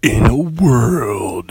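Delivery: slow, dramatic trailer narrator; drawn-out epic voiceover; movie trailer guy. Retold or imitated. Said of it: "You can't forget movie guy".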